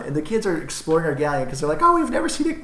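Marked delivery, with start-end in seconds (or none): 1.8-2.6 high, hammed-up kid voice; twelve-year-old voice. Kids exploring the galleon, twelve-year-old voice. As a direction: squeak it like a wide-eyed kid. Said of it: "Twelve-year-old accent?".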